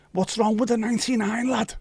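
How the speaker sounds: high put-on Scouse voice; Scouse accent. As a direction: tease him like a Liverpool lad.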